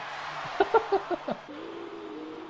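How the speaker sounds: high breathless laugh. Real, not acted. Commentator cracking up at the safety car, high laughter. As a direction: burst out in a long, high giggle.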